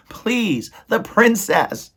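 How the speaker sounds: high, pleading mouse voice; tearful plea. A narrator's reading. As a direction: beg as a crying little mouse. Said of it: "and he was crying now".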